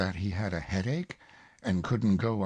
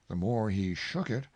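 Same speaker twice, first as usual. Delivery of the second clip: plain reading voice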